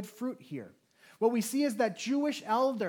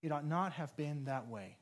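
repeated quietly, lower and softer; softer sincere regret; 5 semitones lower, 8 dB quieter than usual. Real, not acted. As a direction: let the repeat sink in softly. Repeated apology, softer second take.

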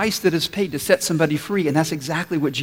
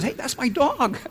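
put-on much higher, a small boy claiming his dog; little boy voice; 9 semitones higher than usual. Preacher voicing the boy to the shop owner, high and childlike. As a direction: pipe it up like a child who found his puppy.

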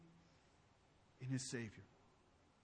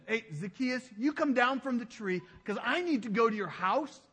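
calling up to Zacchaeus; 5 semitones higher, 4 dB louder than usual. Acted. Voicing Jesus calling up the tree.

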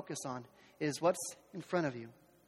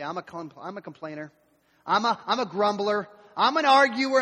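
mock confession; 7 dB louder than usual.

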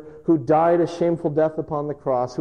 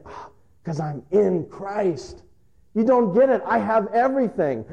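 joyful; 8 semitones higher than usual. Retold or imitated. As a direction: tell them with beaming joy. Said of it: "He's saying".

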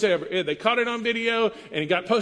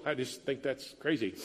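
ordinary talk, nothing marked

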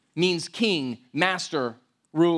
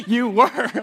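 laughing tease; 6 semitones higher than usual. Real, not acted. Pastor teasing the congregation about running late, laughing. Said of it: "No, I'm just joking".

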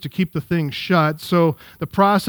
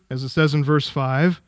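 ordinary delivery

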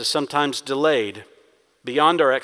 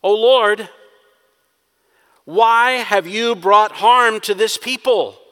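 accusing prayer; 5 semitones higher, 7 dB louder, slower than usual. Acted. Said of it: "This is an accusation".

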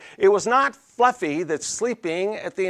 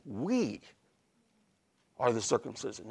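pointed "We", long pause, then quieter; snippy attitude; 6 semitones lower, 10 dB quieter than usual. Acted. Preacher voicing Paul pointing at himself, with understated attitude. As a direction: set "We" apart, smugly claiming it. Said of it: "take your thumb and point it back to yourself".

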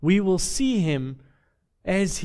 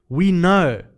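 "We know" said louder, falling firmly on "know"; 6 dB louder than usual. Shown as a demonstration. Preacher stating John's certainty, firm and loud. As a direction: land "We know" as settled fact. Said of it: "It's not "we speculate".

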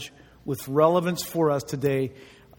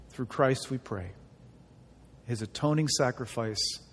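hushed prayer; 5 semitones lower, 4 dB quieter than usual. Acted. Pastor praying before the sermon, soft and hushed. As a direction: pray quietly and reverently.

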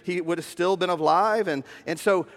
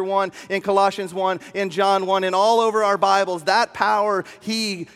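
building sermon; 3 semitones higher, 3 dB louder than usual. Acted. Preacher rousing the congregation, building to full force. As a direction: preach it, rising louder and higher.

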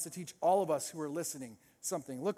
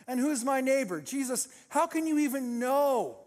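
raised, protesting challenge; defensive; 5 semitones higher, 3 dB louder than usual. Acted. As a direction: push back, stretching the end. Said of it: "gets defensive".

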